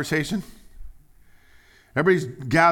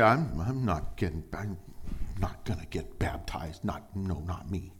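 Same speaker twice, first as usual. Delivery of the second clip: lower, softer, sulky mumble; mock sulky refusal; 5 semitones lower, 5 dB quieter than usual. Refusing bystander muttering his sulky refusal. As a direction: mutter it like a stubborn holdout. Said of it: "you wanna sit back there and say".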